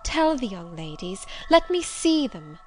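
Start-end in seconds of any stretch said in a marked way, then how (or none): 1.5-2.6 urgent plea. Acted. Stranger pleads to be let in.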